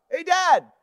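high, loud mock-boy holler; yelling back up. Son yells up to dad a minute later, high shout. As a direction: imitate a son yelling up the stairs. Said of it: "he yells back up".

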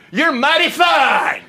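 high, drawn-out catchphrase voice; John Louis. Acted. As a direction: stretch it out high in a playful imitation. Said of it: "John Louis would say".

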